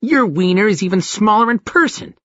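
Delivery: put-on high childlike taunt; toddler alien voice. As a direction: sneer it like a nasty toddler. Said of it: "It sounded like a toddler".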